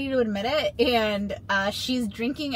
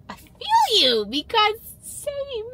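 much higher, squeaky put-on voice, the second part slow; high silly voice; 12 semitones higher, 4 dB louder, much slower than usual. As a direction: answer in a tiny, high comic voice. Joking self-voice reply to character.

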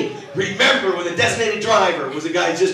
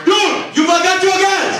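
high, loud yell after a car; guy chasing him down yelling about gas; chasing shout; 11 semitones higher, 4 dB louder, slower than usual. Retold or imitated. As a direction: call out to a driver pulling away. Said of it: "this guy was chasing me down going".

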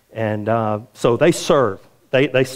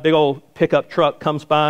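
normal talking voice

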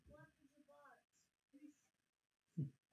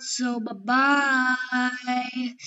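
slow goodbye; 7 dB louder, much slower than usual. Real, not acted. Drawn-out goodbye.

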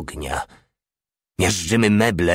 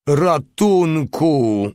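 much higher, louder, slow howl; howling for help; 8 semitones higher, 4 dB louder, much slower than usual. Acted. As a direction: wail it out in panic. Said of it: "zawył". (przeciągłe, dużo wyższe wycie o pomoc)

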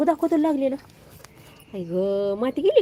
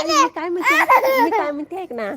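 laughing; 11 semitones higher, 4 dB louder than usual. Real, not acted. Mother laughing as she coaxes her little son to listen.

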